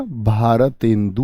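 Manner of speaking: Bharatendu stretched out slowly, low; slowly sounded out. Shown as a demonstration. Teacher giving students the joined word, slowly sounded out. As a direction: draw out each part of Bharatendu. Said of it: "तो क्या बना?".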